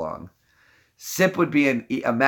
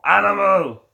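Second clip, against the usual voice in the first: Animal yell; 7 semitones higher, 5 dB louder than usual. Pretend. Shouting Animal.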